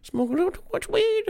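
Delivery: very high, squeezed little voice; squeaky mock confession. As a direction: admit it in a silly falsetto. Mock confession of own faults.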